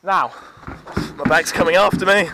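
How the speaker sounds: loud call down a slide. Real, not acted. Sending bag and child down the slide, calling out. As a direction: project it to someone at a distance.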